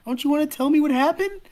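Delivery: high-pitched, insistent appeal; earnest pleading. Acted. Girl on her bed urging friend to talk, pleading. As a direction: plead with an old friend to open up.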